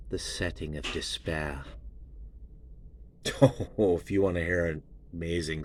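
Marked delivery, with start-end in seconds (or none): 1.7-3.7 breathy laugh. Real, not acted.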